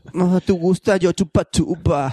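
imitating a movie line. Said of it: "Así es como hablan las putas en Vietnam".

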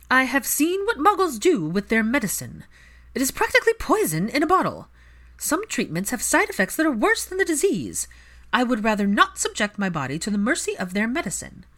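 cool matriarch defending her choice; proud aristocratic mother. Acted. Narcissa justifying herself to the healer, haughty and firm. Narrator voices Narcissa at length.

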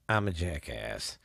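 ordinary talk

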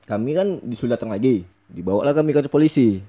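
ordinary talk

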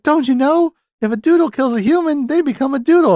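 high, loud cartoon voice, flustered; hammed-up Lonnie. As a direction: squeak it out like a cartoon girl. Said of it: "She's like".

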